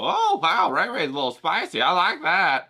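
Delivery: high, loud, impressed voice of imagined fans. Retold or imitated. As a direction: gush like a delighted viewer. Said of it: "people go".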